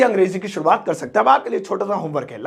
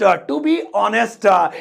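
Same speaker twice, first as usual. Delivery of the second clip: very drawn-out English phrase; slow model for copying; slow teaching repeat; much slower than usual. Shown as a demonstration. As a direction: stretch it out for learners.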